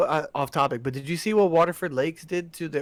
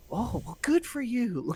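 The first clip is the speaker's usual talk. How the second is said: much higher, slow, sarcastic; lightly mocking coworker voice; 9 semitones higher, 6 dB quieter, slower than usual. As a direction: imitate a snide coworker, dragged out. Mimics coworkers' reaction.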